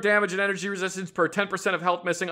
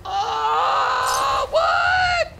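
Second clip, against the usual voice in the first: shocked. Real, not acted. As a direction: squeal it in surprise. Shocked by the creepy voice.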